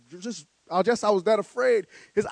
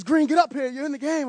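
raised coach's call, higher pitch; 5 semitones higher than usual. Retold or imitated. Coach calling the rookie into the game, raised and urgent. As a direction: shout him off the bench.

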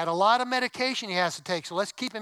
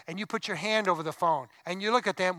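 ordinary talk

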